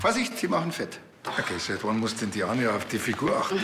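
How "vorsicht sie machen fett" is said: higher, teasing warning; mock warning voice. Detective teasing Anja about pastry, playful. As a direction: tease her about the croissant.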